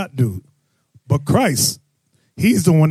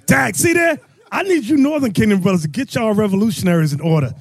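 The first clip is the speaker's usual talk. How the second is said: ordinary talk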